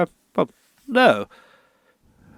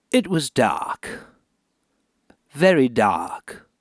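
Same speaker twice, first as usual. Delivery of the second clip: story narration; 5 semitones lower than usual. Acted. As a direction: read it with doom in every word. Reads his grim book aloud.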